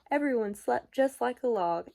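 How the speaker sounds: ordinary delivery, nothing marked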